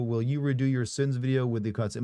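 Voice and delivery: ordinary delivery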